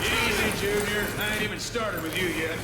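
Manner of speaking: menacing taunt. Acted. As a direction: taunt the captive like a sadist. Torturer taunting captive son.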